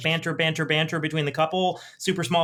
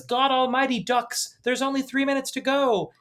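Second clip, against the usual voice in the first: mock exasperated outburst, higher pitched; rude husband; 8 semitones higher than usual. Panicked husband to wife as the missiles come, mock exasperated. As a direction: act out an irritated husband, voice raised in pitch. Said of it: "he's quite rude to his wife".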